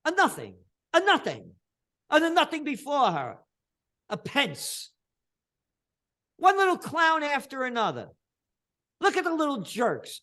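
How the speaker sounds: high, loud contemptuous yelling; furious mocking outburst; angry rant. Real, not acted. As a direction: spit out the scorn. Ranting about politicians, rising contempt.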